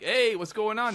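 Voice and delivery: lightly acted call to passing troops; tank guy. Tank guy to arriving soldiers, lightly acted hail. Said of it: "the tank guy's there being like".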